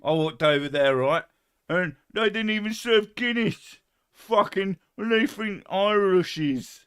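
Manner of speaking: high, loud put-on American accent; mock American accent. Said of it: "this is my American accent now".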